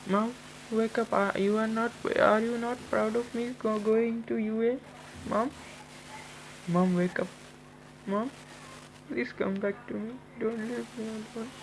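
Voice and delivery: high, soft pleading sob-voice; crying for mom. Acted. Izuku pleading over his dead mother, high and tearful. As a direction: beg her to wake up, voice high and breaking. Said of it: "Izuku started to cry".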